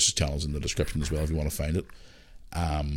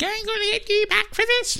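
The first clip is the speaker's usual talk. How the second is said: put-on much higher, like a twelve-year-old before his voice broke; squeaky kid voice. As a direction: squeak it out like a young boy. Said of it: "my wee voice is like".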